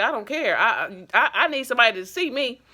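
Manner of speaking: retold insistence. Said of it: "I was like".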